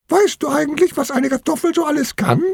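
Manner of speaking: high, small talking-vegetable voice; little potato. A narrator's reading. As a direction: ask in a tiny, high squeak. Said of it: "fragt die Kartoffel".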